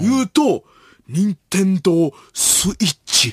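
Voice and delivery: 伊集院光. Acted. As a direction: draw out the answer like a feigned discovery. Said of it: "ひらめきだけで". (もったいぶって答える伊集院のものまね)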